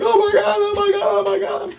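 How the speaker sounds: hammed-up freakout of a friend in tears; imitating frantic friend. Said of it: "He's crying and running around in circles".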